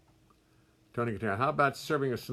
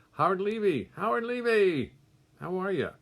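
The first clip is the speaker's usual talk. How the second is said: much higher and louder, thrilled to see him; delighted greeting; 10 semitones higher, 6 dB louder than usual. Real, not acted. Musician spotting a friend in the chat, delighted. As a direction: sing out the name in joyful surprise.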